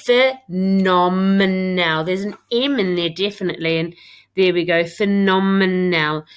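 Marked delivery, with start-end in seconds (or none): 0.1-2.0 "Phenomenal" said very slowly and louder, each syllable pulled apart. Shown as a demonstration. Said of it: "let's say that slowly". 4.8-6.2 "Phenomenal" said slowly, every syllable clear; slow, deliberate "Phenomenal". Shown as a demonstration. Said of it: "I said it slowly".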